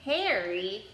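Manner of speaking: ordinary delivery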